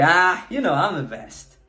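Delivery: goofy, offhand little boast; silly brag. Shown as a demonstration. Coach demoing a silly brag to students, playful. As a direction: toss it off playfully, not meaning it. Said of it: "Or you can do it in a silly way".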